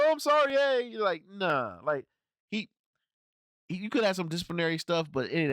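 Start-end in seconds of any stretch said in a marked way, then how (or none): 0.0-0.9 mock pleading surrender. Mocking a surrendering villain.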